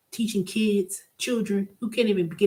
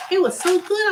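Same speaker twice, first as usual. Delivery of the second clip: much higher, louder burst of delight; 12 semitones higher, 5 dB louder than usual. Real, not acted. Telling viewers about the peach tea, delighted gush.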